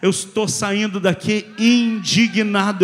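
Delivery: very loud, driving exhortation; full-force preaching. Real, not acted. Preacher urging the congregation to leave indignant, full-force shouted preaching.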